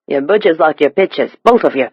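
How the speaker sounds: thick East End Cockney; Cockney accent. A narrator's reading. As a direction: say it as a London Cockney stranger. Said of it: "The stranger's Cockney accent was nearly impenetrable".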